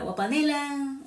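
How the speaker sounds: put-on Huila accent. Speaker recalling her childhood Huila speech, high lilting accent. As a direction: say it like a little girl from Huila. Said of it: "yo siempre decía".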